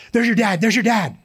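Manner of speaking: teasing friend. Retold or imitated. Friend teasing him at the food court, mock alarm. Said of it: "just kidding".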